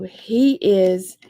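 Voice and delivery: usual speaking voice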